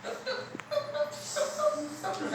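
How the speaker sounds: tiny high, soft "tuk" hops; light, quiet patter of a hopping rabbit; hopping noises. Acted. Rabbit sets off for the cave, soft hopping sounds.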